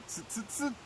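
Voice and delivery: soft, high mimicking chatter; quietly tsk back like cheeky monkeys. A narrator's reading. Monkeys copying the peddler, soft chattering. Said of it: "stamped their feet back at him and said".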